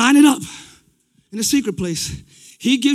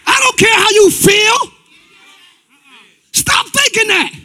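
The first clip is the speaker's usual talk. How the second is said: high, loud rebuke from the pulpit; full-force preaching; 8 semitones higher, 5 dB louder than usual. Acted. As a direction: preach it hard, higher and forceful.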